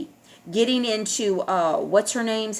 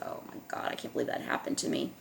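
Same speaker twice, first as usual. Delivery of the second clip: whispered retelling; 12 dB quieter, faster than usual. Retold or imitated. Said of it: "I told Tina, I said".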